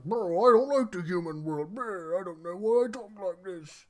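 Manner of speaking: hammed-up lunk who says bro a lot; mock dumb brute Yami. Recapping Yami complaining about the human world, in a mock dumb brute voice. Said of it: "And Yami's like".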